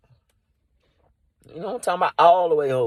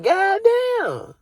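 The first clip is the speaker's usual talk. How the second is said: amazed exclamation; 11 semitones higher than usual. Retold or imitated. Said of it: "I'm like".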